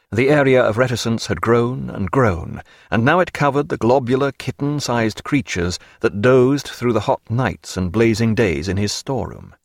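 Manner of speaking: ordinary delivery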